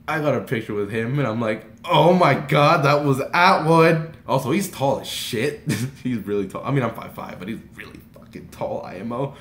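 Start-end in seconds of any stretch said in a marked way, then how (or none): 1.8-4.2 slow, loud amazement; awestruck excitement. Retold or imitated. Fan after a photo with Atwood, starstruck. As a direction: draw it out, loudly starstruck.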